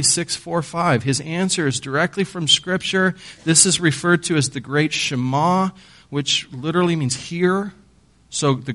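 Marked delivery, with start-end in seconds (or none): none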